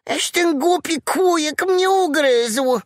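high little piglet voice, outraged; indignant piglet. A narrator's reading. Piglet cursing the mosquito that bit him, high and outraged. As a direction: squeal it like a wronged piglet.

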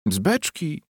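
high incredulous repeat; mocking echo. A narrator's reading. Wife throwing husband's answer back, high mockery.

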